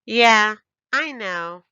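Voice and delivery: put-on bragging little girl; bouncy loud child voice; proud child. A narrator's reading. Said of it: "Ebony said".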